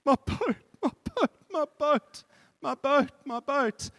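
high, loud weeping cry over and over; boy weeping over his returned boat. A narrator's reading. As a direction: cradle it and cry out.

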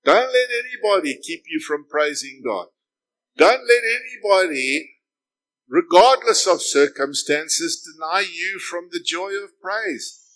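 loud, rising preacher's call; fervent preaching. Acted. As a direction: drive it home to the congregation. Preaching at full force.

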